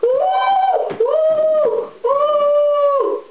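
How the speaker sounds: very high, loud monkey shrieks; monkeys shouting. A narrator's reading. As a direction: shriek back like wild monkeys. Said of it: "they all shouted back at him".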